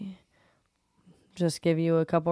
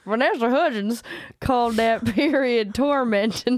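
put-on Southern accent; 3 semitones higher, 4 dB louder than usual. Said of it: "Please go back to the regular [name] voice".

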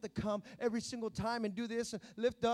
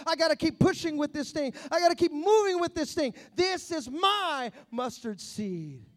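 much louder and higher, stretched out on grace; preaching at full force; 5 semitones higher, 11 dB louder than usual. Acted. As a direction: drive it home to the congregation with full force. Preaching faith as a shield.

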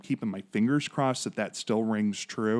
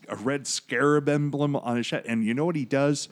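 normal talking voice

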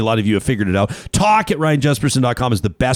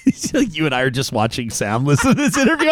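ordinary delivery

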